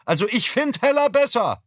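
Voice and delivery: very high, loud call; ringing from the kitchen. Acted. Mother's voice rings out from the kitchen, high and loud. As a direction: let it ring out from the next room.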